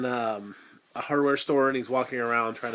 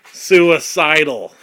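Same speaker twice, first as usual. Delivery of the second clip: "suicidal" drawn out slowly and much louder; dramatic emphasis; 4 semitones higher, 13 dB louder, much slower than usual. Pretend. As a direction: lean hard and slow on "suicidal". Dramatic reveal after gasp.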